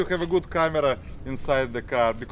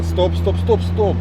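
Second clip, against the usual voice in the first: loud urgent commands; urgent shout; 6 semitones higher, 8 dB louder, slower than usual. Real, not acted. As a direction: shout it to stop the car. Instructor halting the student driver.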